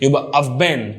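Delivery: ordinary talk, nothing marked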